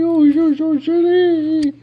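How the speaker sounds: very high, slow, drawn-out coo; high baby talk. Acted. Owner cooing to his dog, high and slow.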